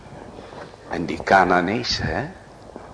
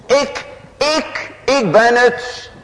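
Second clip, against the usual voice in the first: high, loud cry of a woman owning her guilt; confessing woman; 5 dB louder, slower than usual. Acted. Preacher voicing a woman who confesses her guilt, high and loud.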